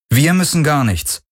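high, cool rebuff; understated cold defiance; cool refusal. A narrator's reading. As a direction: refuse coolly, pitch lifted. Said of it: "Kirais Stimme war kühl".

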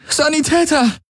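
shouting for help. A narrator's reading. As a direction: yell for a medic. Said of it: "rief sie". (laut rufend)

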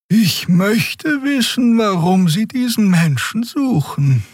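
very high, slow character voice; Xea musing aloud. A narrator's reading. Character wondering about the manhunt, musing aloud. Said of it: "überlegte Xea laut".

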